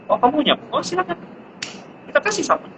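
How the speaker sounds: sweet high praising voice; encouraging parent. Retold or imitated. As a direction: cheer the kid on like a toddler's parent.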